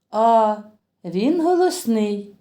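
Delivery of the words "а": ordinary talk, nothing marked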